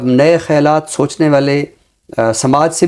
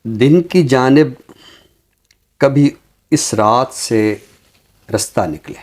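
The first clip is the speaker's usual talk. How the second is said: ghazal recitation. Acted. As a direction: savor each line like a mushaira reading. (धीमी, ठहरी हुई ग़ज़ल-ख़्वानी)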